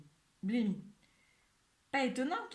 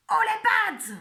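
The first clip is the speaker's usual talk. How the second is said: very high, very loud menacing yell; terrible icy voice; 13 dB louder, slower than usual. Acted. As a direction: freeze the fox with a threat. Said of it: "d'une voix terrible, froide comme la glace, il grince entre ses dents".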